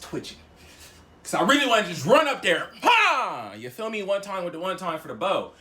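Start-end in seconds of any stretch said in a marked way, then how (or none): 2.8-3.5 high explosive "pa"; sudden vocal smack; imitated punch sound.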